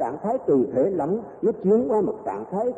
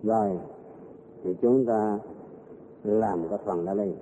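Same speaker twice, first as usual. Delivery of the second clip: normal talking voice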